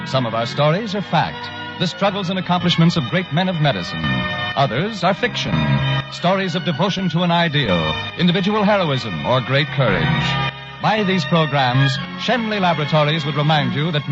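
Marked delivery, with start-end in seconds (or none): none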